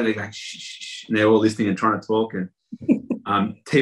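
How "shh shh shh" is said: sharp whispered shh, almost silent; shushing. Retold or imitated. Crew hushing each other to hear the radios, whispered shushing. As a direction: hush everyone urgently under your breath.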